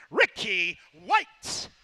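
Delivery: slow, loud, drawn-out name call; announcer call-out. Real, not acted. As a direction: boom the name to the crowd. Announcer calls out guest's name.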